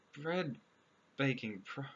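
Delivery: normal talking voice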